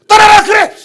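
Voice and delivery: loud, high dismissive yell; angry shout. Acted. Pastor reacts to member leaving church, angry shout.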